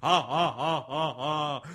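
evenly spaced villain laugh; monster laugh. Acted. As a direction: laugh in separate gloating "ha"s. Monster laughs after its roar.

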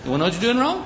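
high, soft rising question; insistent helper's singsong query; pushy know-it-all. Acted. Know-it-all asks once more.

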